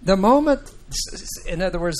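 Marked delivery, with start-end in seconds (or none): 0.0-0.7 MOMENT pitched high and loud; emphatic. Shown as a demonstration. Teacher stressing the statement about Adam's death, emphatic. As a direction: punch "the moment" up high and hard. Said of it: "there's an emphatic emphasis in Hebrew".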